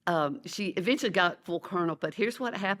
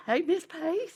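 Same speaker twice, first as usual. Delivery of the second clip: voicing the student; 11 semitones higher, slower than usual. Former student greets her teacher.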